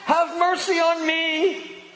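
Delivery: high pleading beggar's cry; blind man calling out. Retold or imitated. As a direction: cry out for mercy from the roadside. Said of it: "calls out".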